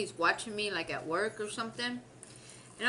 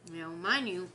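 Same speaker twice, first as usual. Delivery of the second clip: ordinary delivery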